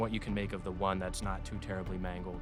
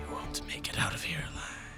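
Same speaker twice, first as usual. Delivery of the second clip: high, fast raspy death whisper; 7 semitones higher, faster than usual. Acted. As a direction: hiss a final curse. Dying creature's voice in head.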